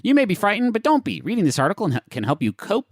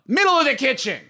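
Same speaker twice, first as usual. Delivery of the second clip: high-pitched comic ghost voice; insistent ghost; 11 semitones higher than usual. Acted. Ghost orders where the sofa goes, insistent ghost. Acts out ghost demanding placement.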